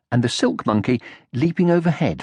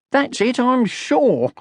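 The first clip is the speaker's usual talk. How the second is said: high, slow, eager little creature; Sniff voice; 13 semitones higher, slower than usual. A narrator's reading. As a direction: sure of himself, voiced high.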